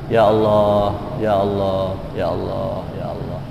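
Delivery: repeated plea fading to very soft; hushed private prayer, acted out; intimate supplication.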